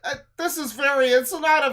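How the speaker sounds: high, loud hammed-up spirit voice; mock alien channeler. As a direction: play a cheesy channeled space being. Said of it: "she closes her eyes two seconds later".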